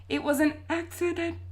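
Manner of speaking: high robot monotone. A narrator's reading. Boy excusing himself to his sister, in robot voice. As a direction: say it like a robot by accident. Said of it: "You just did it again".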